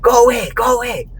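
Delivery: high put-on shooing voice; mock shooing. Woman stepping aside shooing me, high mock voice. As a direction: shoo him off, hammed-up.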